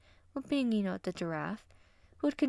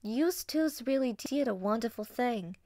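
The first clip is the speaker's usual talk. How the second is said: put-on higher-pitched character voice in a Barks accent; 5 semitones higher than usual. Hyena congratulating her mate and his brother, Barks accent. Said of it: "Ada said in her Barks accent".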